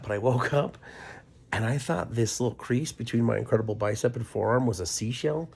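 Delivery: usual speaking voice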